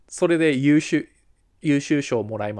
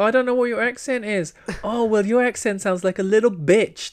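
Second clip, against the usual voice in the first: mock bratty jeer; 8 semitones higher, slower than usual. Student mocking the teacher's accent, hammed-up jeer. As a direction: tease like a cocky student, hammed up.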